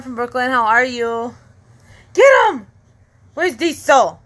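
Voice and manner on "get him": excited urging. Real, not acted. Egging on during play with toddler.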